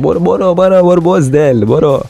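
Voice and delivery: high, jeering brush-off; scornful shooing. Real, not acted. Dismissing the liar from his stall, high scornful jeer. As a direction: run him off with scorn.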